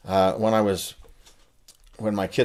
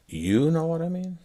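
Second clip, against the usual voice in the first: "know" swooped much higher; stress on know; 10 semitones higher than usual. Shown as a demonstration. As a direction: sing "know" up high in "You know what I mean?". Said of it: "I change the meaning subtly by changing the emphasis".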